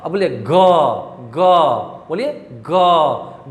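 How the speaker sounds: ग drawn out slowly, low, with the throat buzzing; slow demo of voiced ग. Shown as a demonstration. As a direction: hum through the ग so the vibration shows. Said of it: "ग में क्या कंपन हो रहा है".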